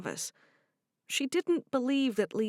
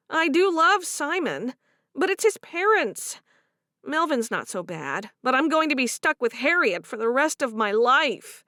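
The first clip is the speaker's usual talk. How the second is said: higher and louder, complaining; frustrated young wife; 6 semitones higher, 5 dB louder than usual. Acted. Favor complaining about Harriet, higher and aggrieved. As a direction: vent about the mother-in-law. Voiced character dialogue.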